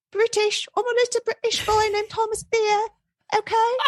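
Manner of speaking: very high, hammed-up British boy accent; bad British accent. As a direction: butcher a British accent like a squeaky little boy. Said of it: "I can't do a British accent".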